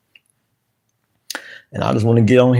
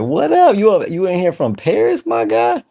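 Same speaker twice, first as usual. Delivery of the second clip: much higher, delighted hello; light up for the viewer from Paris; excited greeting; 8 semitones higher than usual. Real, not acted.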